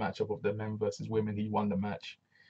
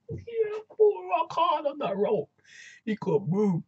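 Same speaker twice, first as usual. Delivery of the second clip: high voice breaking up with laughter; laughing; slower than usual. Real, not acted. Host joking about the man stuck on the rope, laughing. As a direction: squeeze the words out through giggles.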